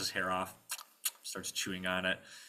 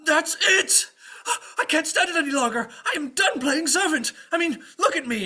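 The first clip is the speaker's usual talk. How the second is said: high, loud exasperated outburst; squire snapping; 10 dB louder than usual. Acted. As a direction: blow up as the fed-up servant. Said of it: "his brain snaps".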